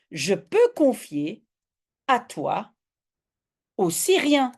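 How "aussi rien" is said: normal talking voice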